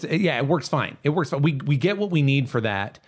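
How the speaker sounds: normal talking voice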